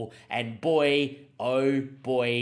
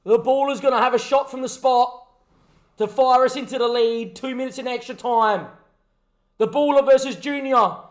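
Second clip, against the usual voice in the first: raised, high play-by-play voice; tense commentary build-up; 9 semitones higher, 7 dB louder than usual. Acted. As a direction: build up to the big kick. Builds up the penalty kick.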